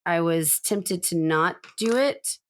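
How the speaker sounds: normal talking voice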